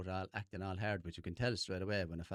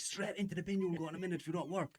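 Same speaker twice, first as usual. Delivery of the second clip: higher, hammed-up threat to a remote control; mock threat; 9 semitones higher than usual. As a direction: scold the remote like a naughty child, voice up high. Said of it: "Threatening, like, inanimate objects".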